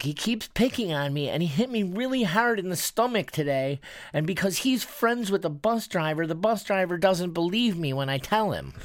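high-pitched imitation of a wronged little boy; teary child. Seven-year-old telling his mom about a bus bully, plaintive high voice. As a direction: pour out a kid's plaintive complaint. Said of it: "he got a little bit teary-eyed".

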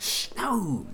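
shushing whisper; urgent shushing whisper; hushing whisper. Acted. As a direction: hush her quickly. Husband hushes his wife.

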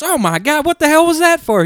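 mock-shocked, very high and loud; shocked outrage. Wife in a joke just smacked by husband, shocked outrage. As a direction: play the wronged wife, voice shooting up.

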